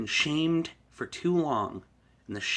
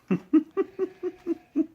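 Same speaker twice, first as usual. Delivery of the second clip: high laugh. Real, not acted. Laughing at own impression.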